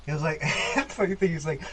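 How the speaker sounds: normal talking voice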